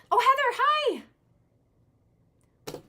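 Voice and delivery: high, loud, glad cry of recognition; light up at seeing a friend; delighted surprise. Real, not acted. Reader spots Heather in the chat, delighted squeal. Greets a viewer gladly.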